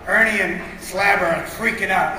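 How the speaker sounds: ordinary delivery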